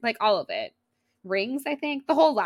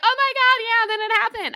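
much higher and louder, thrilled; excited squeal; 7 dB louder than usual. Retold or imitated. Imagined listener squealing as the news lands. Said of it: "Or you'll be like".

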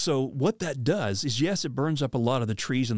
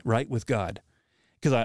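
ordinary delivery

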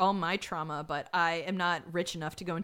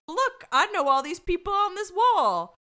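mock boast; 9 semitones higher, 7 dB louder than usual. Rumsey quoted boasting.

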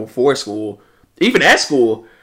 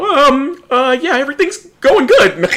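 much higher, loud nervous squeak; flustered Deku; 13 semitones higher, 5 dB louder than usual. Retold or imitated. Diner answering the waiter, squeaking like Deku. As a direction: squeak it out like a flustered anime boy. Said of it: "turning into fucking Deku".

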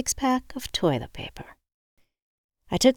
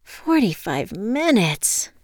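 slow, higher drawn-out whine; 5 semitones higher, much slower than usual. A narrator's reading. Woman alone after the call about the wait, whining. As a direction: drag the words out in a whine. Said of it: "I whined".

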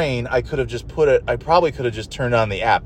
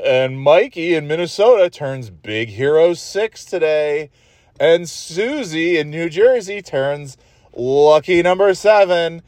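sing-song mock birthday announcer, higher; radio host shtick; 5 semitones higher than usual. Mocking the kids' radio DJ, hammed-up announcer. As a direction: ham up the DJ's birthday shout-outs, slow at the end. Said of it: "he does the same fucking shtick".

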